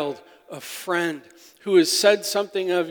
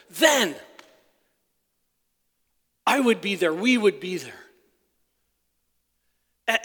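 full-force preaching; 5 semitones higher, 8 dB louder than usual. Acted. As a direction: build each line and hit the key words hard. Preaching the psalm to congregation.

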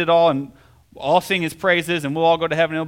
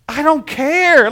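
much higher, louder, slow burst of disbelief; relieved exclamation; 10 semitones higher, 8 dB louder, much slower than usual. Retold or imitated. Groom told of a small dent, loud slow relief. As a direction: blurt it out, almost laughing with relief. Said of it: "And I'm like".

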